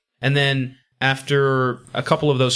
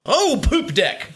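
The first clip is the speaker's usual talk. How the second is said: high, drawn-out cartoon outburst; mock-surprised cry, pitched way up; comic exclamation; 11 semitones higher, much slower than usual. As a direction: blurt it as a pirate's startled oath. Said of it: "the top four exclamations".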